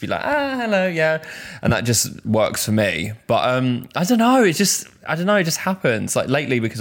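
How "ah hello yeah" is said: chirpy hello. Retold or imitated. Acting out a cheerful greeting.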